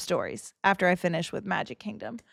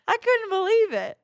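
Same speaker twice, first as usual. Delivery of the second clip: high, emphatic exclamation; stunned, pitched way up; amazed disbelief; 11 semitones higher, 4 dB louder than usual. Real, not acted. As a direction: burst out high in sheer disbelief. Exclaiming in disbelief over the story.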